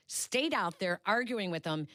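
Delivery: normal talking voice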